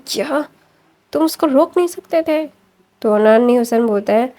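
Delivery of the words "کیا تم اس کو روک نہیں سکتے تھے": pitched up high in shocked reproach; a mother's sudden alarm, voice jumping up; shocked disbelief. Retold or imitated. Mother learns her son was taken, shocked high-pitched reproach.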